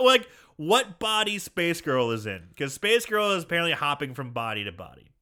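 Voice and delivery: normal talking voice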